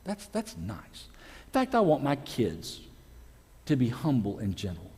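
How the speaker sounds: quiet, wistful thinking aloud, sinking at the ends; soft approving musing. Acted. Ladies musing about kids and husband, soft wistful approval.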